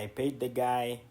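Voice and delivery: ordinary talk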